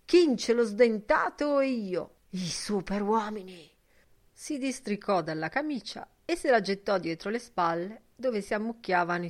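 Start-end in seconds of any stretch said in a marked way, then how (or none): 0.0-3.7 loud, slow, grandiose declaration; shouted proclamation. A narrator's reading. Mulligan proclaims himself and Kinch supermen, crying out slowly and grandly. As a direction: proclaim it loud, stretching the words out. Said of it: "gridò".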